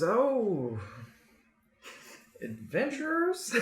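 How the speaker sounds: high, slow questioning lift; surprised blacksmith. Acted. Blacksmith notices visitors in his shop, high drawn-out surprise. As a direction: draw it out as realization dawns. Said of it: "he goes".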